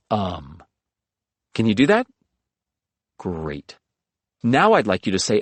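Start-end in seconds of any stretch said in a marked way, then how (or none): 0.1-0.6 demo filler sound. Shown as a demonstration.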